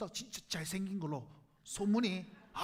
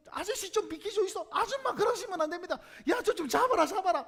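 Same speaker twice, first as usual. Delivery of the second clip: louder harried calls to the crowd; frantic disciples yelling; 7 semitones higher, 6 dB louder than usual. Retold or imitated. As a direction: hold back the mob, shouting. Disciples pushing back the crowd.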